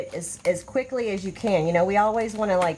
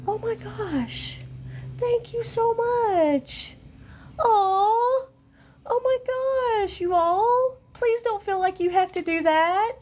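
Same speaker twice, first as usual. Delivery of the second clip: high, drawn-out tearful thanks; touched, near tears; 12 semitones higher, slower than usual. Real, not acted. Host thanking viewers for gifts, high and tearful. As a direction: squeak it out, overwhelmed with gratitude. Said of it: "You are not gonna make me cry".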